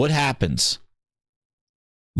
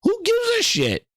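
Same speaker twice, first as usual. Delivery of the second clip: much higher, incredulous yelp; pitch-cracking scoff; exasperated squeak. Real, not acted. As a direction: fling it out in disbelief. Exasperated retort to Microsoft.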